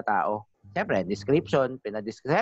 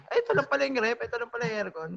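mock surprise; 9 semitones higher, faster than usual. Voicing owner finding lost items.

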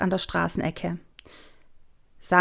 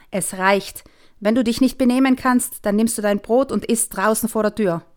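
angry mother; 3 semitones higher, 6 dB louder than usual. Acted. Mother scolding daughter at breakfast, angry raised voice. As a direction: lose patience and tell her off.

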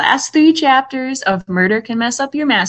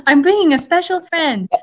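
mock cutesy; 9 semitones higher than usual. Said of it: "It sounds like she's bringing a hamster".

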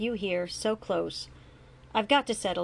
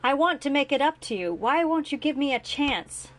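higher, louder urgent plea; pleading; 7 semitones higher, 5 dB louder than usual. A narrator's reading. Grant to his son, raised pleading. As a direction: beg him for a chance.

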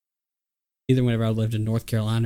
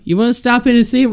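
mock chaperone offer; 12 semitones higher, 5 dB louder, faster than usual. Leaders offering Mount Rushmore stop.

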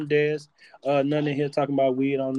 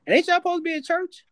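high, teasing mock rebuke; mock scolding; 12 semitones higher than usual. Host teasing his co-host about cursing, high mock scolding voice. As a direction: needle her in a high put-on voice.

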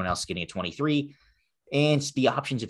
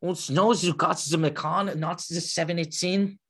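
high-pitched, badly faked German accent; mock German accent, pitched up; horrible German accent; 8 semitones higher than usual. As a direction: put on a hammy, terrible German accent. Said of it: "That was horrible German accent".

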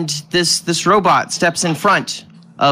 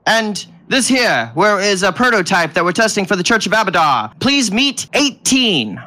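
little bald man; 3 semitones higher than usual. Acted. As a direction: present your companions like a tiny showman. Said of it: "And he goes".

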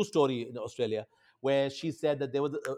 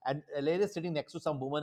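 ordinary talk, nothing marked

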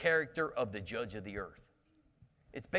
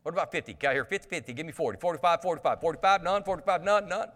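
auctioneer; 2 semitones higher, much faster than usual. Retold or imitated. Preacher playing Abraham as an auctioneer counting down. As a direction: rattle off the bids like an auctioneer calling a sale. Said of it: "He kinda sounds like an auctioneer".

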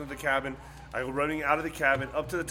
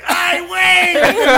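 very high, loud, drawn-out holler; astonished fisherman's shout; 16 dB louder, much slower than usual. Retold or imitated. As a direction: imitate a Mexican fisherman's stunned yell. Fisherman yells on seeing them.

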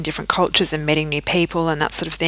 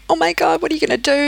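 much higher, imitating alarmed relatives; shocked family; 12 semitones higher, faster than usual. Family reacts to her pregnancy news, high imitated shock. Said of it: "They were like".

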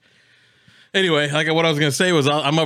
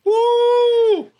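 high, loud whoop; 6 dB louder than usual. Real, not acted. Mouth burning from hot chips.

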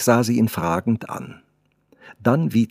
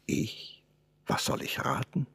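a soft, much quieter whisper; 11 dB quieter than usual. Acted. The artist answers the girl's riddle in a whisper. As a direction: whisper the question back shyly. Shy lovers' hushed exchange.

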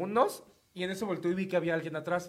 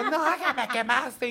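slow, higher, exaggerated grievance; mock angry old lady; 5 semitones higher, much slower than usual. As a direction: whine it like the offended lady. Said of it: "Y ella".

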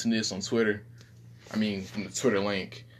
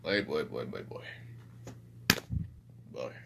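put-on lower, softer repeated boy; his voice; 11 semitones lower, 10 dB quieter than usual. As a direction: drop low and murmur boy. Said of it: "I'm just gonna talk in his voice".

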